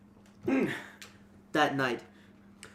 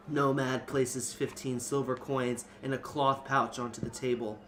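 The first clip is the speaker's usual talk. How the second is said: normal talking voice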